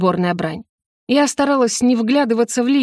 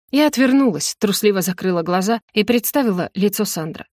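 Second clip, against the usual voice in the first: plain reading voice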